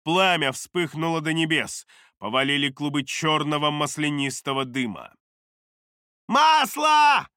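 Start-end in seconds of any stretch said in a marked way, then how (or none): 6.3-7.3 high, loud alarmed cry; shout. A narrator's reading. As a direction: yell it out in alarm. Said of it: "выкрикнул кто-то".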